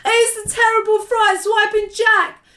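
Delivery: high-pitched wailing terror; bellowed. A narrator's reading. Giant attacked by Jack in a nightmare, bellowing. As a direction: bellow each cry in rising panic, drawing it out. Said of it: "bellowed the flesh lump eater".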